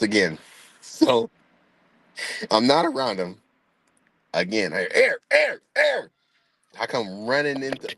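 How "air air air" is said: high panicked calling; mock yell of a scared dad, high; yelling a name.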